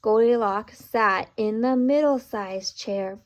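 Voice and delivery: plain reading voice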